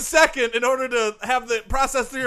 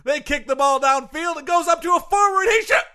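sports announcing; 3 semitones higher than usual. Acted.

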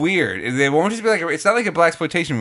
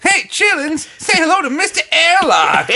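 sassy grandma voice; 4 dB louder than usual. Acted. Madea threatens a space villain.

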